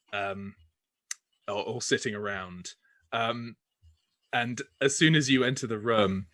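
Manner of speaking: normal talking voice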